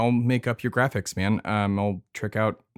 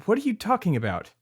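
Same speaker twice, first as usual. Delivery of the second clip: baffled disbelief; 9 semitones higher than usual. Real, not acted. Shocked by the odd name.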